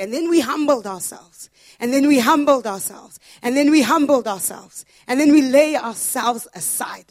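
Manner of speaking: rising from firm to loud, repeated declaration; building proclamation. Retold or imitated. Preacher voicing Old Testament leaders, building to a loud cry.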